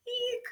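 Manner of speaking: high, soft frightened eek; tiny squeak. Acted. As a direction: squeak like a scared mouse.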